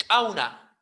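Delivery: "kauna" with a popped ejective K; explosive K. Shown as a demonstration. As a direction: click the K hard before "kauna" opens. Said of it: "Una K explosiva".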